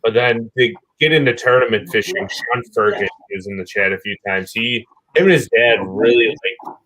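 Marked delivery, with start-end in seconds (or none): none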